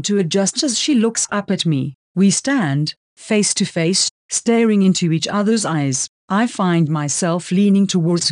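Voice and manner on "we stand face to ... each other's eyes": usual speaking voice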